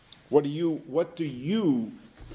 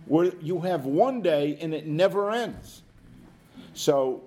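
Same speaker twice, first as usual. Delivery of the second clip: ordinary delivery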